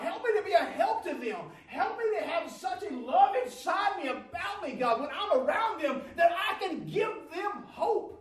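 high, loud, soaring preacher's cry; full-force preaching. Acted. Preacher pleading with the congregation, full-force high cry. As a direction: pour it out to the church at full force.

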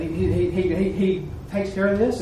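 usual speaking voice

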